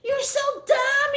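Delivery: very high, loud put-on woman's shriek; hammed-up shrieking woman; screeching lady. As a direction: rant in a squeaky falsetto. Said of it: "but she's like".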